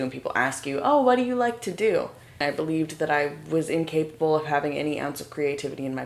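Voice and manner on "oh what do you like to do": high-pitched imitated small talk; raised-pitch quoted question; curious questioner. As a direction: imitate a chirpy, polite question. Quotes people asking about hobbies.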